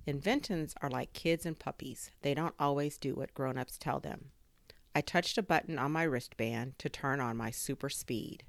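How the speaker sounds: ordinary delivery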